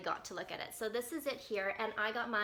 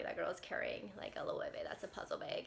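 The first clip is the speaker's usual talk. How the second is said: whispering; 4 semitones lower, 6 dB quieter than usual. Retold or imitated. Inner thought on seeing bag.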